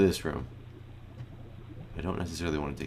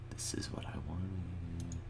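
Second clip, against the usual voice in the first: hushed, slow whisper to himself; 12 dB quieter, slower than usual. Real, not acted. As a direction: breathe it out secretly. Sneaking past a witch in a game.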